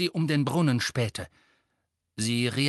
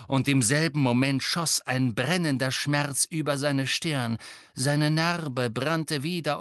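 usual speaking voice

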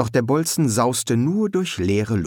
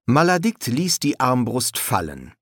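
ordinary delivery, nothing marked

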